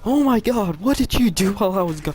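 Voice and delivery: high, loud shocked outburst; hammed-up high-pitched alarm; imitating shocked date. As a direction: mimic an appalled date demanding answers.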